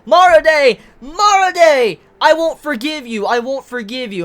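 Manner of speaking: loud, high-pitched acted scream; imitated screaming. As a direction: cry the name out high and loud, easing slightly on the repeated vow. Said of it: "he starts screaming".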